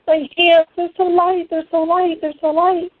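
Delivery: high, repeating in wonder; awestruck. Retold or imitated. Telling her husband her hands feel light, awestruck and high. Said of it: "I just keep saying over and over".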